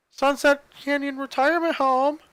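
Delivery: lightly voiced cheery receptionist; happy receptionist. A narrator's reading. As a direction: receptionist answering the phone, a hint of cheer. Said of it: "a happy voice stated".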